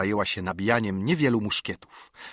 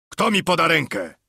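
higher, louder roar for help; 5 semitones higher, 5 dB louder than usual. A narrator's reading. Fallen Silver roaring for someone to lift him. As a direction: bellow at the garrison. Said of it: "ryczał Silver".

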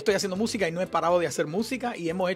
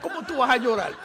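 laughing retort; 6 semitones higher than usual. Retold or imitated. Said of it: "y yo digo".

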